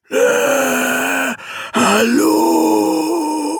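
high, strained monster voice; a demon clearing its throat to begin; awkward high demon greeting. Acted. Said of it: "begann er".

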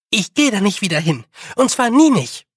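put-on high, loud stubborn girl; defiant declaration. A narrator's reading. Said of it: "verkündete sie".

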